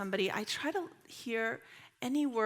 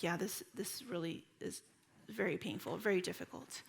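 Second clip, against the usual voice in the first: soft empathy; 2 semitones lower, 7 dB quieter than usual. Acted. Visitor affirming a hurting person's feelings, soft empathy. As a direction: affirm the pain gently and softly.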